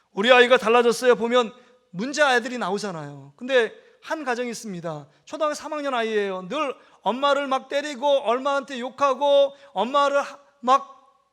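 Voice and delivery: ordinary delivery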